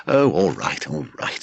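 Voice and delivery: a fed-up, giving-in sigh of a reply; exasperated. Acted. Leslie gives in to his brother, exasperated. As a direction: concede the point with weary irritation. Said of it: "said Leslie exasperately".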